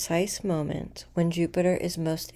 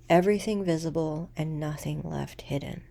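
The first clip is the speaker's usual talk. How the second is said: ordinary talk, nothing marked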